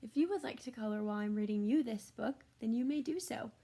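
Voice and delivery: usual speaking voice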